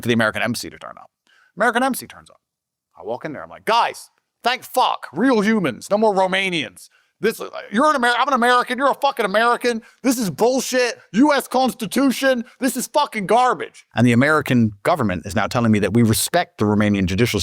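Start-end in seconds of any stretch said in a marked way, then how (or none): 3.6-9.8 outraged rant. Retold or imitated. Andrew rants at the American embassy staff, high and outraged. 10.0-11.0 "This is bullshit" said high and indignant; outraged. Retold or imitated. Andrew to the embassy: "This is bullshit", high and outraged. 11.1-13.9 outraged rant. Retold or imitated. Andrew rants at the American embassy staff, high and outraged.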